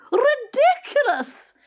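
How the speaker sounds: high, loud furious cry of a queen; shrieked royal fury; stormy outburst. A narrator's reading. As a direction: explode at the king's endless socks. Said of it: "she stormed".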